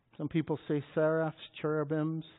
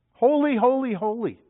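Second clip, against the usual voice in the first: emphatic holies; 8 semitones higher, 8 dB louder than usual. Acted. Preacher repeats holy.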